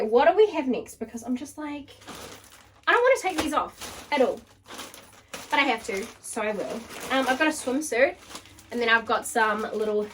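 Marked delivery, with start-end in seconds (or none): none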